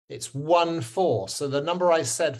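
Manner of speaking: ordinary talk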